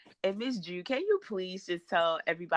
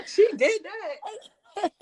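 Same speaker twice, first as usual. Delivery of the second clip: high, slow, laughing delight; cracking up as she says it; laughing through the words; 14 semitones higher, much slower than usual. Real, not acted. Laughing at guest's catchphrase.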